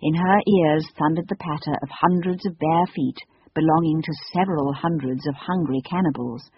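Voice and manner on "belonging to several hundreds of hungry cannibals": plain reading voice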